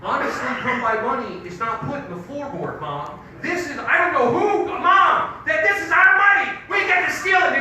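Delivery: loud, high, indignant rant at mom; heated yelling. Retold or imitated. Kid yelling at his mom about stolen money, indignant. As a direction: yell it like a kid caught stealing.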